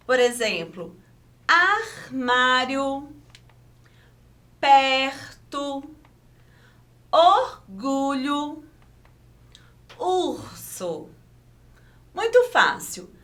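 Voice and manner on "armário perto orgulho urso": slow, split syllables with a throaty R; strong R. Shown as a demonstration. Teacher giving examples of R after a vowel, strong R. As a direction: stretch the R in "armário" and "perto" into a throat rasp. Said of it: "tem um som forte".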